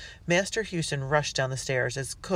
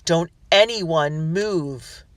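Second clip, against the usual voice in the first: slow, loud command; each word a warning; father ordering the household, slow and firm; 3 semitones higher, 7 dB louder, much slower than usual. A narrator's reading. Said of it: "he ordered".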